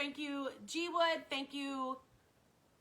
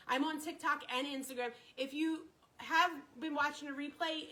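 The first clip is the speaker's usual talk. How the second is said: ordinary talk, nothing marked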